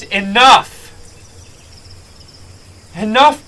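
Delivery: loud, sharp cry of enough; commanding shout. Acted. Undril shouts to stop the quarrel, loud and commanding. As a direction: cut them off with a loud shout.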